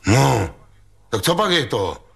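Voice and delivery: a touch of an indignant hoot; gruff call. A narrator's reading. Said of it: "houkl v tom Ostrohradský".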